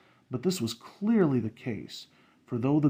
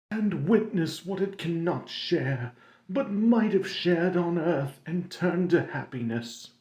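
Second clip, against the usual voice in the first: Marley's wailing ghost; 6 semitones higher than usual. Acted. Marley's ghost lamenting to Scrooge, higher and mournful. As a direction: moan it like a doomed spirit.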